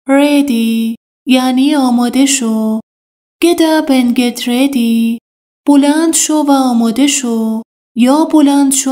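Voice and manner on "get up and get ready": slow model sentence. Shown as a demonstration. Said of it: "teacher repeats the model sentence".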